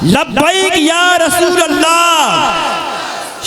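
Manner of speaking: high, drawn-out rallying shout; slow, ringing slogan call; devotional slogan. Real, not acted. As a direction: lead the crowd's chant at the top of the range. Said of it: "मोहब्बत से नारा लगाएं".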